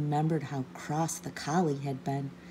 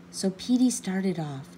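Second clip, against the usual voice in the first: ordinary delivery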